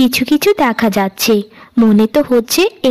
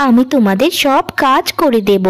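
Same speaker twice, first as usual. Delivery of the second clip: ordinary delivery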